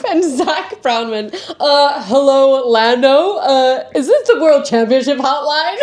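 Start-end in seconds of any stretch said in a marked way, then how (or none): 1.6-5.8 hammed-up American accent, higher and louder; slow, over-the-top American phone greeting. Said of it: "Is that your American accent".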